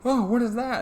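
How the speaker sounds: mock panic rising to a high, loud gasp; scared, confused. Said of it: "he's all scared about the sky".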